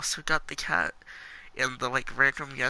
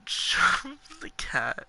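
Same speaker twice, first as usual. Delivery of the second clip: slow, whispered laugh; laughing disbelief; 12 semitones higher, 3 dB louder, much slower than usual. Real, not acted. Reading the shocking game option, slow laughing whisper.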